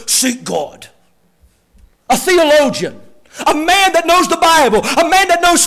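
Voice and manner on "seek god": low, soft, curt brush-off; a lightly voiced cold friend, clipped and dismissive; curt dismissive Eliphaz. Preacher mimics Eliphaz brushing off suffering Job, low and curt. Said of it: "I can almost hear Eliphaz saying it like this".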